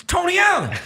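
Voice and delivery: opgewonden herkenning; excited recognition. Retold or imitated. Fan repeats name to girlfriend.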